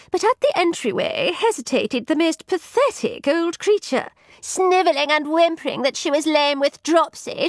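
high-pitched, indignant gossip; fretful lady in waiting. Acted. Ladies in waiting recount the old beggar to their visitor, high and fretful. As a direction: voice an agitated lady's maid up high.

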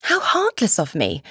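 usual speaking voice

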